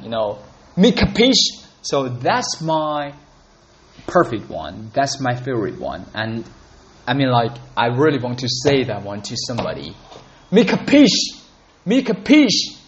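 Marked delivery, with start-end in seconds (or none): 0.8-1.6 higher, louder tough-guy question; robust tough gangster. Retold or imitated. Mobster threatening someone who won't listen, loud and high. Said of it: "really robust, strong". 10.5-12.8 much higher, louder menacing mobster; threatening gangster. Retold or imitated. Gangster threatening to beat someone up, loud and high. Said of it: "if you don't listen, I'll beat you up".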